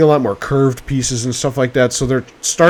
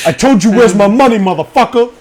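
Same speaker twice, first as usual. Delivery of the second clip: mock tough-guy yell; 6 semitones higher, 4 dB louder than usual. Acted movie quote, laughing.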